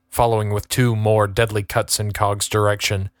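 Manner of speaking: usual speaking voice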